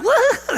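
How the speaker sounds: loud, very high whoop; mock daredevil cry, high and loud; athletic whoop. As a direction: whoop like someone leaping off things. Imitating athletes jumping over obstacles.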